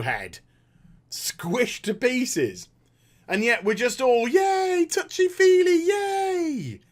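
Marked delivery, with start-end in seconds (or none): 4.3-6.8 high, drawn-out mock yay; sarcastic cheer. Viewers all happy at the ending, mock cheering. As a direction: gush with fake delight.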